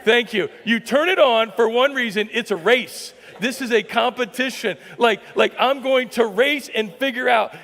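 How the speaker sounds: loud, high preacher calling out to the men; rally the room, pushing it up; fired-up preaching. Acted. Pastor stirring up the congregation, loud and high.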